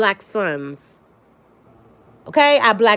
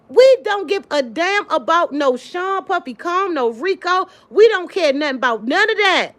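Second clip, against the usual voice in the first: higher and pushed, fired up at the chat; heated rant; 8 semitones higher than usual. Real, not acted. Host to her livestream viewers, heated and fed up. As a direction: set the record straight with real heat.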